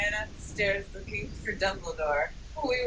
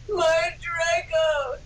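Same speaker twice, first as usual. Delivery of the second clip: slow, higher mock wail; hammed-up moan; 6 semitones higher, much slower than usual. Reader hams up a vampire's grief, slow mock moan. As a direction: wail it, half laughing. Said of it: "he moaned".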